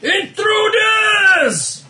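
high, slow, loud alarm cry; alarm shout. Acted. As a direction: raise the alarm, drawn out. Guard raises the alarm.

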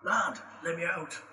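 pleading dwarf in fake Scottish; fake Scottish accent. Prisoner dwarf explains himself to the party, light Scottish accent. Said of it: "You and your fake Scottish accent".

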